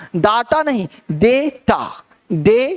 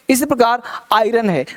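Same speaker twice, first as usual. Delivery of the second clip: ordinary talk, nothing marked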